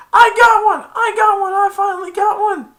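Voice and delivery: very high, loud jubilant yells; elated shouting. Retold or imitated. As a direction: crow over a long-sought prize. Acting villain's elated shouting.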